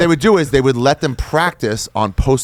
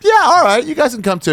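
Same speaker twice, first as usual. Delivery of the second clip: comic God voice; 13 semitones higher than usual. Retold or imitated. God lets the slaves come along, comically agreeable. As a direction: play God giving in, pitched way up. Acting God's reply.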